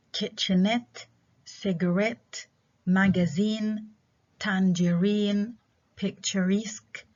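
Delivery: kitchenette, cigarette, magazine, tangerine, picturesque, each stressed at the end; stress last syllable. Shown as a demonstration. Teacher listing examples, kitchenette to picturesque with final stress. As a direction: lean on the endings from kitchenette to picturesque. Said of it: "stress on the last syllable".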